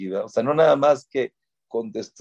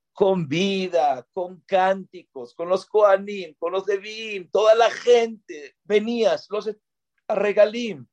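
nostalgia exaltada; 8 semitones higher than usual. Acted. Evoca el Templo vivo con emoción.

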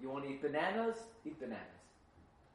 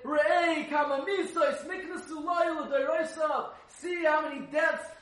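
higher, louder impassioned sermon; fiery preaching; 9 semitones higher, 11 dB louder, slower than usual. Acted. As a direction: preach the text at full force. Preaching Torah text at full force.

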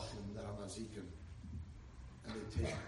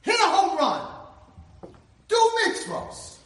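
high, loud, slow rallying cry; urging call; 17 dB louder, slower than usual. Acted. God sending us out each morning, loud and slow. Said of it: "He's like".

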